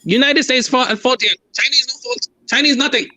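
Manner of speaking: high-pitched mock Chinese accent, broken English. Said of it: "Would you stop cultural appropriating a Chinese accent?".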